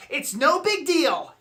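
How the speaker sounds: normal talking voice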